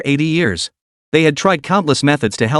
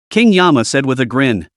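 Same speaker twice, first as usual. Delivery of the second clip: ordinary delivery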